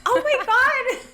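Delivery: high, loud real astonishment; genuinely floored, pitch leaping up; shocked disbelief. Real, not acted. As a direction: exclaim in stunned disbelief, breaking into a laugh. Learns her moon sign is different.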